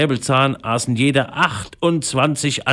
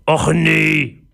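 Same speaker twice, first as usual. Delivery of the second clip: raised-pitch grumble of dismay; grumbling pirate; 6 semitones higher, 4 dB louder than usual. A narrator's reading. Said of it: "brummte Säbelzahn". (brummelnder, enttäuschter Pirat)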